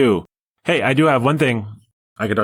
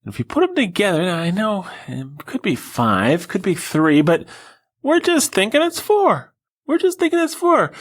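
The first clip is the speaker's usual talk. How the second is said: mock reasoning; 8 semitones higher than usual. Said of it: "because we said".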